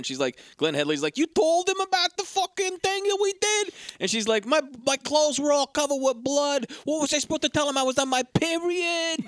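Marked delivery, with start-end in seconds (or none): 1.2-3.7 very high mock screech; hammed-up outrage, pitched way up; outraged disbelief. Imitating Glenn Headley's character confronting her friend, outraged. 4.5-9.2 high, hammed-up protest climbing higher; frantic self-defence. Imitating Demi Moore's character excusing herself, frantic.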